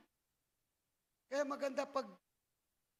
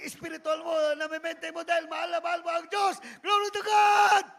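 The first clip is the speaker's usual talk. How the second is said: shouting praise; 2 semitones higher, 11 dB louder than usual. Acted. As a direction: lift the room with a shout. (malakas na sigaw ng papuri)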